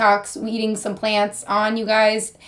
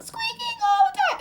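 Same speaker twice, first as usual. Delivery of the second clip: tiny, extremely high squeak voice; 4 dB quieter than usual. Shown as a demonstration. Showing viewers how to squeak, squeaky voice. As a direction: squeak the words out on barely any air. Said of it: "I can squeak and I'm not actually using any air".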